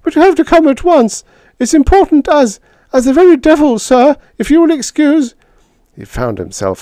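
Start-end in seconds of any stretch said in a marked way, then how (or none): 0.0-5.3 very high and loud, cracking with urgency; a young aide's panicked shrill squeak; shrill, voice breaking. Acted. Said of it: "he shrilled, his voice breaking".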